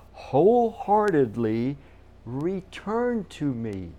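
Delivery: "wholeheartedly" very slow and loud; deliberate, heavy weight on "wholeheartedly"; stress on wholeheartedly. Real, not acted. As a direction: stretch "wholeheartedly" syllable by syllable, then fall on "me". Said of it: "Do you see the emphasis?".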